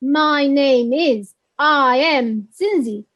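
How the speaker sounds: slow, every word of "My name is" separate; uncontracted forms. Shown as a demonstration. Teacher showing what learners won't hear, slow full forms. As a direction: spell out "I am" as two careful words. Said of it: "They won't hear".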